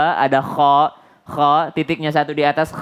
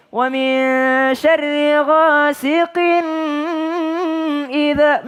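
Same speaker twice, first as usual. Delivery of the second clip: Sudais; 8 semitones higher than usual. Retold or imitated. Teacher mimicking reciters who copy a famous qari, high chant. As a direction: show off a high chanting voice, droning on GHASIQIN. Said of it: "mirip-miripin sama Sudais".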